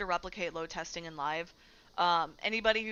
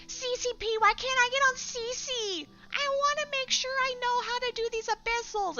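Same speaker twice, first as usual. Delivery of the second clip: very high nasal-free whine of a demanding gamer; whiny Eve player; 3 dB louder than usual. Retold or imitated. Streamer mocking Eve players begging CCP, high whine. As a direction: beg the devs in a high whiny mock voice. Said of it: "This voice".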